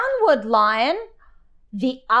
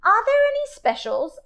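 high, quick hopeful question; eager child; 11 semitones higher, faster than usual. Acted. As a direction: ask brightly, hoping for spaghetti. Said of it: "I asked".

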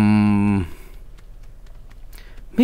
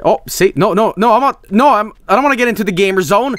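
normal talking voice

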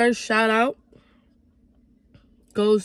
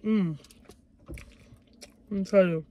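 talking between bites; chewing while talking; 2 semitones lower, 3 dB quieter, faster than usual. Real, not acted. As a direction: mouth partly full of food. Seen 4: Bites wing just before; lips closed, chewing early, food not swallowed yet.

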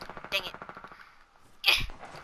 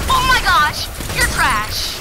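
taunting shout; 11 semitones higher, 13 dB louder, slower than usual. Real, not acted. Taunting an opponent in game.

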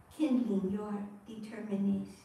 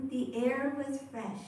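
usual speaking voice

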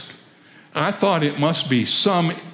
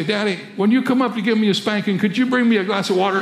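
put-on quick, higher little-boy voice; 6 semitones higher, faster than usual. Boy asking his father for water, innocent child voice. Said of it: "heard his little boy say".

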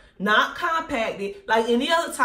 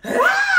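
short high shriek, much higher; retold scream; 5 dB louder than usual. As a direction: shriek at the sight of the possum. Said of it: "I mean, scream".

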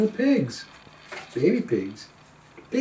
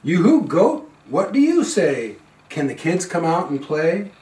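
louder call to the goat; 2 semitones higher, 6 dB louder than usual. Acted. Calling to goat.

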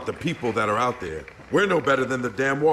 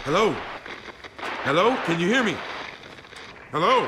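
calling out; 8 semitones higher than usual. Acted. Calling into the radio.

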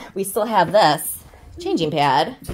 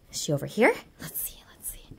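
whisper; 7 semitones lower, 8 dB quieter than usual. Acted. Sneaking during hide and seek.

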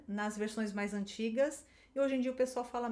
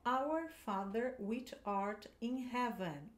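slow, careful English read-aloud; teacher reading a prayer line slowly; slow model reading; slower than usual. Shown as a demonstration. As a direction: read it slowly for students to copy. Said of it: "você vai praticar a pronúncia".

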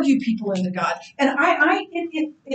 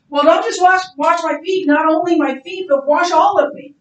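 higher, louder eager overreach; eager Peter; 6 semitones higher, 7 dB louder than usual. Retold or imitated. Peter begging Jesus to wash him entirely, higher and eager. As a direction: push it, all in. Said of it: "so then he says".

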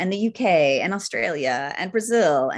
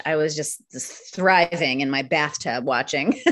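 ordinary talk

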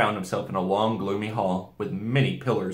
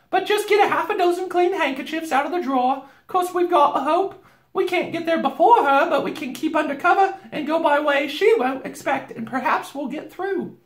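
very high, bustling housewife; Missus Beaver; 6 dB louder than usual. A narrator's reading. Beaver wife planning the escape, high and practical. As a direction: instruct and encourage in a high voice.

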